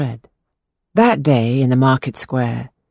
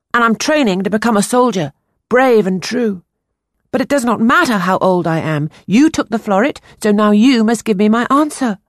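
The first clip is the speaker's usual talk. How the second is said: put-on higher, earnest young boy voice; 7 semitones higher, 4 dB louder than usual. A narrator's reading. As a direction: play the anxious ten-year-old boy. Boy Peter voiced in story.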